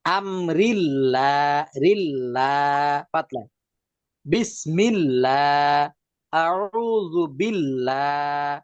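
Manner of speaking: slow recitation with a thin, light L in আমরিল্লাহ; light Allah. Shown as a demonstration. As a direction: draw each word out with a light lam. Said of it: "সবগুলো পাতলা হবে". (টেনে পাতলা উচ্চারণ)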